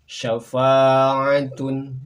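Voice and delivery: "Syafa'atun" very slow and loud, glottal catch, ending tun; model Arabic reading. Shown as a demonstration. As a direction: stretch out "Syafa'atun". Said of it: "samakan dengan contoh pelafalan kami". ("Syafa'atun" sangat pelan dan lantang)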